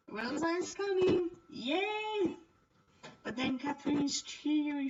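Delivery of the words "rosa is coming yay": high, loud squeal of joy; excited cheer. Acted. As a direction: cheer like a delighted child.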